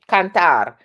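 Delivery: "Cantar" with open A and rolled final R; rolled Spanish R. Shown as a demonstration. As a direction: stress the last syllable of "Cantar" and roll its R. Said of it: "is a rolled R".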